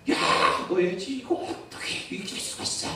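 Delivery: mock-angry child, high and breath-heavy; imitated angry outburst. Brother scolding sister over a broken toy, mock anger. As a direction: act out a little boy's outraged protest. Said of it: "요셉이가 막 화를 냈어요".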